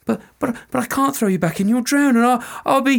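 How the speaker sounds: panicked soldier. Acted. Soldier pleads with the fairy woman, frantic and high. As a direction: babble out excuses in fear.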